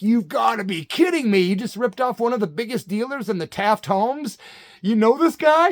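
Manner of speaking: mock yell at the buddy who ripped off the dealer; shocked outrage. Retelling yelling at his buddy in the car, shocked and loud.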